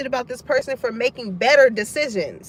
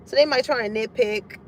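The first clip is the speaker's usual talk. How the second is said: ordinary delivery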